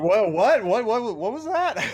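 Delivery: high, startled stammer; confused shock. Retold or imitated. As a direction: sputter it out in disbelief. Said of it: "He's like".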